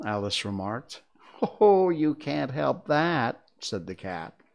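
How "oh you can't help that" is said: slow, louder knowing cat voice; amused cat, savouring the point; snooty bored cat. Acted. The Cheshire Cat voiced in story.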